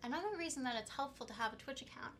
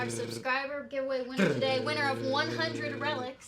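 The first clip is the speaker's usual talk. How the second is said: booming prize announcer; 16 dB louder than usual. Acted. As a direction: announce the winner like a game show. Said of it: "should we announce our subscriber winner".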